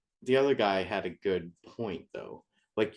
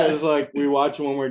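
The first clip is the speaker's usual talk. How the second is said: laughing; 5 semitones higher, 7 dB louder than usual. Real, not acted. Both laughing.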